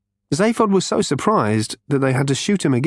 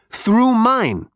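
higher, character voice for the alien; 10 semitones higher than usual. A narrator's reading. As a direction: lift into the alien's voice. Character voice continues.

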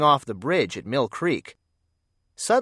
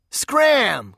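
loud bully's bark, higher; growled; 9 semitones higher, 5 dB louder than usual. Acted. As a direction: snap it at the little kid. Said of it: "growled Bugs".

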